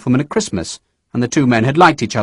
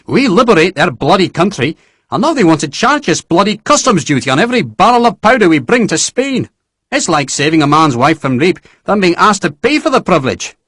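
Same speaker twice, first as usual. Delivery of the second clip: higher, louder indignant general; Nairn ranting; 6 semitones higher, 3 dB louder than usual. A narrator's reading. General rants to Sharp about customs, indignant. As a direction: fume at the Spanish.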